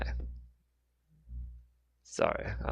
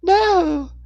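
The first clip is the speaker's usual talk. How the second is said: put-on very high, loud child protest; mock hissing boy; 11 dB louder than usual. A narrator's reading. Boy objecting to being left, high-voiced protest. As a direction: cry it out in a small boy's high pitch. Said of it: "the boy hissed".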